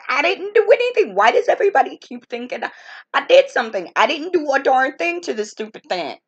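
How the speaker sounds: indignant protest. Retold or imitated. Answering the aunt who thinks she provoked the goose, loud indignant protest. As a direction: insist you're innocent, fed up with being blamed. Denying blame to her aunt.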